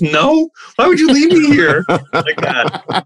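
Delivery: high, whiny mock complaint from the girlfriend; upset girlfriend. Wife complaining in retold story.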